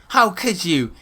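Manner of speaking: dramatic, high-pitched reproach; mock outrage. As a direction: imitate an appalled girlfriend. Acted outraged mom or girlfriend.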